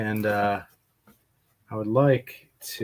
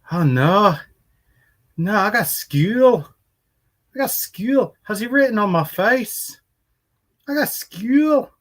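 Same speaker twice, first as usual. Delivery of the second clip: put-on high, loud little Irish girl voice, slowed; high mock Irish child accent; 11 semitones higher, 7 dB louder than usual. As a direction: imitate a little Irish girl whining about school. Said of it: "that accent".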